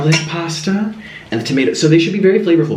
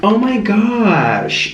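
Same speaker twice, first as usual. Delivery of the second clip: 1 oh my gosh; 5 semitones higher, much slower than usual. Real, not acted. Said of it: "tasting the sauce".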